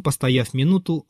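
ordinary delivery

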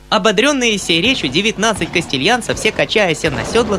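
put-on small, clear, high little boy's voice; even singsong schoolboy recital; child's rote recitation. A narrator's reading. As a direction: rattle off the verses without commas or stops. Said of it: "ровным и ясным голосом, без запятых и без точек".

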